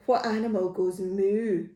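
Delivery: plain reading voice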